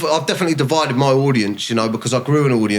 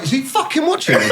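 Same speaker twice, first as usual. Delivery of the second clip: laughing mock quote. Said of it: "and he was like".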